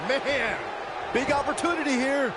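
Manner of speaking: excited high play-by-play call; wrestling commentator. Real, not acted. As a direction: hype the big move live. Live play-by-play of the match.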